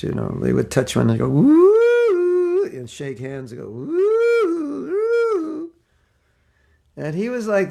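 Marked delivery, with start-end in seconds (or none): 1.3-2.6 high, silly falsetto whoop; Three Stooges whoop. Retold or imitated. Stooges fan shaking hands, silly high whoop. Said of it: "make stupid sounds". 3.7-5.7 high, silly falsetto whoop; Three Stooges whoop. Retold or imitated. Stooges fan shaking hands, silly high whoop. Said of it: "make stupid sounds".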